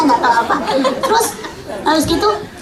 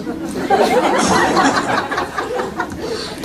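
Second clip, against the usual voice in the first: high, airy giggling; long laugh; 8 semitones higher than usual. Real, not acted. Speaker recalling her naive childhood answers, laughing. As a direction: break into a long amused giggle.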